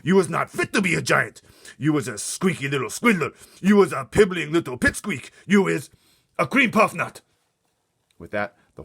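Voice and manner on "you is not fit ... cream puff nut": high, very loud insulting shout; angry shout. Acted. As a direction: yell the insult in his face. Insults continue.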